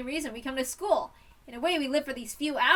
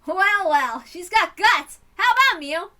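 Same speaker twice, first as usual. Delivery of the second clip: high, loud, rousing delivery; impressed hearty approval; 7 semitones higher, 11 dB louder than usual. Acted. Club leader urging others to let her try, loud and impressed. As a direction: cheer the newcomer on with gusto.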